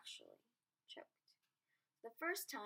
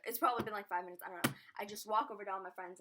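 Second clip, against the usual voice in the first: normal talking voice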